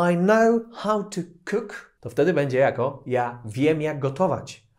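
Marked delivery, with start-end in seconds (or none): 0.1-1.9 slow, higher "I know how to cook"; slow model sentence. Shown as a demonstration. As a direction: spell it out slowly for learners. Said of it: "takie dłuższe zdanie".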